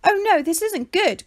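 a flustered ostrich panicking a little; mild storybook alarm; dismayed cry. A narrator's reading. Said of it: "cried Ollie".

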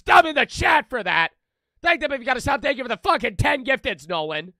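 loud, high, rapid-fire thanks; hyped shouting. Real, not acted. Streamer hyping chat over gifted subs, loud and excited. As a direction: yell every name like it's a win.

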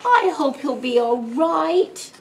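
high, slow tearful grandma; storybook weepy grandma; sniffly worry. A narrator's reading. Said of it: "sniffled Grandma".

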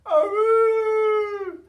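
very high, very loud alarmed howl; dog howling to warn of fire. A narrator's reading. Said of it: "he howled as loud".

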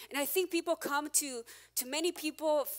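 ordinary delivery

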